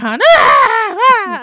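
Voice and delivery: sudden high, loud mock bawl; imitated toddler wail. Mother imitating toddler pulling her trousers, mock bawl. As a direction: burst into a hammed-up toddler cry. Mimicking toddler crying tantrum.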